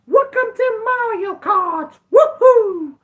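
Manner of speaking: very high, slow falsetto cheer; high-pitched, drawn-out whooping impression; game catchphrase impression. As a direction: squeal it like an excited cartoon game character. Mimics the game's catchphrase as joke.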